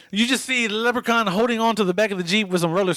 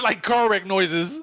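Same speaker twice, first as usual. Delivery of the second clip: ordinary delivery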